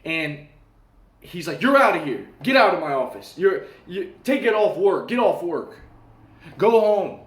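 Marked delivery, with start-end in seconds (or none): 1.6-7.2 loud, higher-pitched mock tirade; boss blowing up. Boss firing the worker who talked back, angry outburst. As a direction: imitate an enraged boss throwing someone out. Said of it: "he would go insane".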